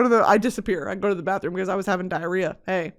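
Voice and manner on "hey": ordinary delivery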